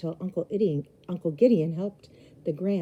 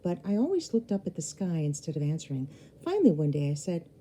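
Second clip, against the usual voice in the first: plain reading voice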